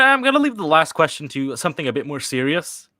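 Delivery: ordinary delivery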